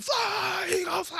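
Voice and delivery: screaming. Retold or imitated. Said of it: "I was like".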